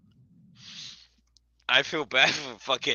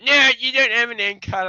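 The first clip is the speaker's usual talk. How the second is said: higher, loud protesting voice; mock protest; 11 semitones higher, 8 dB louder than usual. Discord crowd protests to Rosie, higher mock protest. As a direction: object like a fussy rule-keeper. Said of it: "And they're like".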